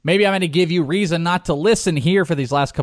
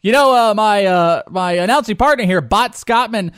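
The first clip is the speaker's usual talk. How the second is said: announcer voice; 6 semitones higher, 3 dB louder than usual. Pretend. Mock broadcast with announcing partner.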